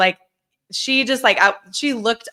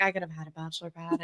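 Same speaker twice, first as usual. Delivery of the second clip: much softer, quick mock-grumble; grumbling James; 3 semitones lower, 13 dB quieter, faster than usual. Wife mocking her husband's lost bachelor pad, a soft quick grumble. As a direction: sulk it under your breath as the aggrieved husband.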